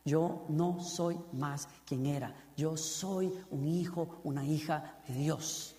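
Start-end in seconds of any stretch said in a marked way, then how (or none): none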